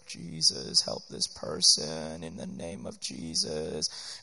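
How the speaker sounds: a lightly hammed low, slow murmured prayer; mock prayer. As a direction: drag it out like an unsure helper praying. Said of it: "So we're just trying to be supportive".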